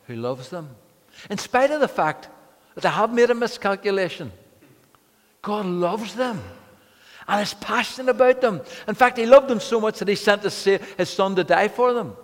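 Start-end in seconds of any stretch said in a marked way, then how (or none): 1.3-12.2 preaching at full force, much higher and louder; passionate preaching. Acted. Pastor to the congregation, fervent full-force preaching. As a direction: lift it into a fervent climax about God's love.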